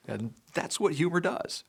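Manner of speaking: usual speaking voice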